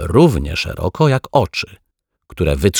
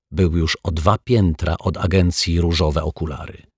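usual speaking voice

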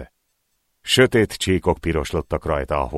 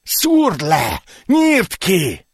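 much higher, louder bloodthirsty yelling; urging shout; 12 semitones higher, 4 dB louder, much slower than usual. Acted. Second thug yelling at the knifeman to kill, frenzied. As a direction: egg him on to kill, shouting.

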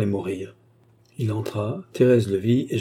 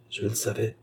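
murmured; 7 dB quieter than usual. Acted. Baronet to himself, reading the news, murmured. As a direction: murmur it as if to yourself. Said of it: "murmura-t-il".

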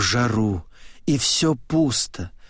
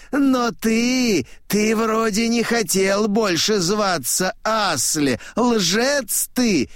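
high-pitched accusation; high-voiced old man denouncing; old man accusing; 9 semitones higher than usual. A narrator's reading. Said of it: "говорит он".

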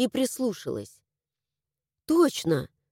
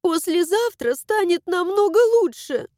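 put-on tearful girl voice; 8 semitones higher, 3 dB louder than usual. A narrator's reading. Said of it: "схлипнула".

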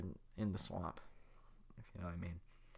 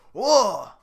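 much higher, loud yelp; startled whoa; 11 semitones higher, 21 dB louder than usual. Acted. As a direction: jump back in surprise. Startled by Donkey.

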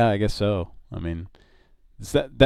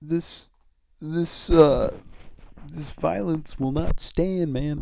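George Bush impression; 6 semitones higher, slower than usual. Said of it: "Repeating George Bush".

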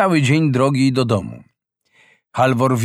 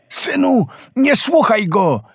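desperate shout; 10 semitones higher than usual. A narrator's reading. Father shouting to save his son from the shark, desperate. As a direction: yell to break the spell. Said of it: "krzyczał stary rybak".

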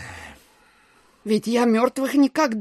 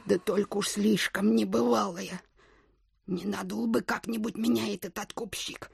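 soft, hesitant musing; doubtful murmur; 7 dB quieter than usual. Acted. Widow wondering if the stranger will cheat her, soft worried murmur. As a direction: think it over under the breath.

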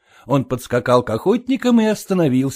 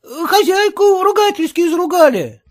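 put-on boy voice; 4 dB louder than usual. A narrator's reading. Boy reports the row.

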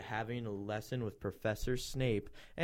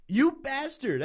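high, loud outburst; acted angry yell; mock angry shout; 9 semitones higher, 5 dB louder than usual. A narrator's reading. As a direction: read it as an indignant shout. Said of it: "I shouted angrily".

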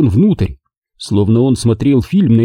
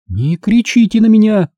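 high indignant protest; 11 semitones higher than usual. A narrator's reading. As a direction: push back at his shout. Margo protests shouting.

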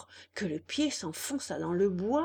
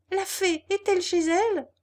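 put-on high, louder boy's voice calling upward; anxious little puppet calling to the slug; Pinocchio calling up; 10 semitones higher, 6 dB louder than usual. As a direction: call up to the window in a high puppet voice. Puppet calls up to window.

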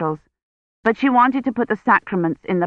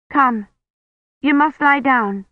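higher-pitched, firm urging; urgent coaxing; 6 semitones higher than usual. A narrator's reading. Kivrin to the priest just taken sick, high urgent coaxing. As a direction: urge him to come, pitched up.

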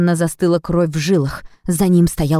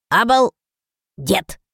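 slow, creaky dragon echo; 2 semitones higher, much slower than usual. A narrator's reading. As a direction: creak the word out slowly. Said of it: "скрипучим голосом".

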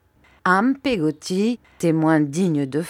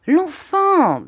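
exasperated cry; 12 semitones higher, 5 dB louder than usual. Acted. Aunt exasperated, clarifies.

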